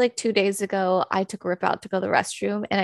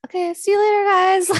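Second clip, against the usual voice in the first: high, louder cheerful sing-song goodbye; breezy goodbye; 11 semitones higher, 6 dB louder than usual. Retold or imitated. Guilty owner imitating her carefree goodbye, high and bright. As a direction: breeze out the door. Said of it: "I'm like".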